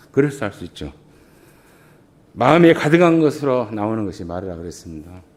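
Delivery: ordinary talk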